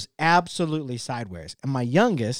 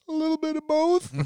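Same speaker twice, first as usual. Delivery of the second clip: put-on tiny high voice of a crying kid; tearful little daughter; 12 semitones higher than usual. Youngest daughter answering dad after his outburst, tearful squeak. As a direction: mimic a small girl answering through tears. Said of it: "She starts crying ... She goes".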